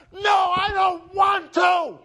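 loud, high, drawn-out refusal; father yelling. Retold or imitated. Dad yelling back at mom, furious refusal. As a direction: bellow a stubborn refusal, slowly. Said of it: "fighting and yelling at each other".

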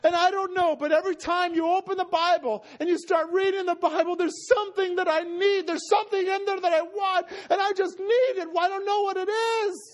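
high, overwrought wail of need; acting out a tearful plea; desperate pleading. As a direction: plead at a high pitch, near tears, building to a cry. Acts out Norman's desperate plea.

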